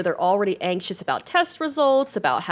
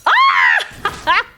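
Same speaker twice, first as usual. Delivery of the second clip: sudden very high scream; startled scream; 7 dB louder than usual. Real, not acted. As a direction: shriek in surprise.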